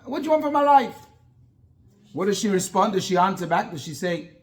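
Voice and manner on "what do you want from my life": higher and louder, an exasperated outburst; angry retort. Retold or imitated. Yaakov snapping back at his pleading wife, angry. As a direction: snap back like a fed-up husband. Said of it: "He gets angry".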